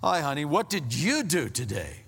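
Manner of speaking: pointed question leaning hard on YOU; loaded greeting with an emphatic YOU; accusing tone. Shown as a demonstration. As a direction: make it an interrogation, swooping on YOU. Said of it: "Or you can say".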